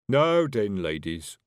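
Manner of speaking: would-be firm, voice sinking on the last word; nervous. Acted. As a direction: start loud, then lose nerve. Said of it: "said Brian nervously".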